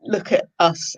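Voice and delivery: normal talking voice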